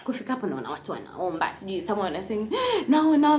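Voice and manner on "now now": sudden loud startled cry; startled shout. Retold or imitated. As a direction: blurt it out in shock. Shocked by people praying around her.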